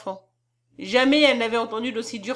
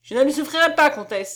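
loud, raised protest; crying out; 4 semitones higher, 7 dB louder than usual. A narrator's reading. Gösta refusing the countess's humiliation, crying out. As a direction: object loudly, with pitch lifted. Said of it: "s'écria-t-il".

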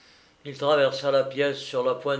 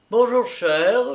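high, loud, drawn-out greeting; hailing acquaintance; 8 semitones higher, 6 dB louder than usual. A narrator's reading. Someone stopping Papillot in the street, hailing him. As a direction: call out a slow, high hello. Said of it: "lui dit quelqu'un en arrêtant".

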